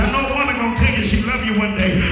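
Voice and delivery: loud, rising sermon cadence; preaching. Acted. Preacher to congregation, full-force preaching.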